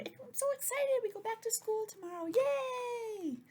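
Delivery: squealing high and loud with joy; excited. Shown as a demonstration. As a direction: show a kid bursting with excitement. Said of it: "I can say".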